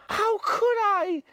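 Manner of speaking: high, indignant protest; high indignant boy's cry. Acted. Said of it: "cried Piggy indignantly".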